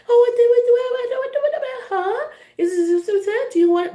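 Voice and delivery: very high, loud mock baby talk; cooing puppy talk. Mocking how they talk to you like a puppy, cooing. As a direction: gush as if to a puppy. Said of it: "they don't really talk like that".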